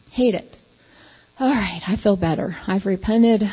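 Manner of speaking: ordinary talk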